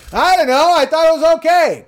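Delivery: very high, loud, strained mock scream; screaming Bill impression. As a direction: screech it like Bill losing it. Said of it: "'Cause he's fucking screaming".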